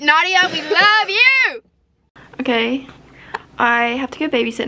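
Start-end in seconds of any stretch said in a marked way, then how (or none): 0.0-1.6 calling out. Real, not acted. Calling out to absent friend.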